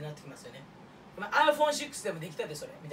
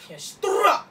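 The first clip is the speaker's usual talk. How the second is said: higher, louder annoyed retort; mock angry shout; 10 semitones higher, 8 dB louder than usual. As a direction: snap back loudly. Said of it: "ってなるよね".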